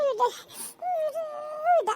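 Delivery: theatrical sobs around the word; put-on sobbing. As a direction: break into mock sobs calling your child. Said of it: "ఎందుకే mummy ఏడుస్తున్నావు". (నటిస్తూ వెక్కి వెక్కి ఏడుపు)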